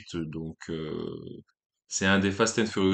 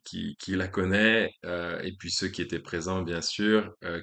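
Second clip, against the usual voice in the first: ordinary delivery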